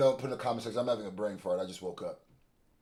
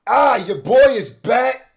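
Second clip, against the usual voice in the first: hype intro; 6 semitones higher, 10 dB louder than usual. Real, not acted. Host opening his reaction show.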